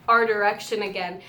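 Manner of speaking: ordinary delivery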